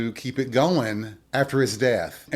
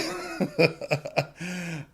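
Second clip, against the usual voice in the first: short, breathy wry laugh; a real laugh after joking about being dead; 4 semitones higher, 5 dB quieter than usual. Real, not acted. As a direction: laugh at your own morbid joke. Laughing at own dark joke.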